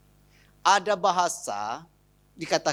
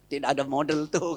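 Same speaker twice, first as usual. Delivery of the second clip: husband's reply; 5 semitones lower than usual. Retold or imitated. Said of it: "kata suaminya".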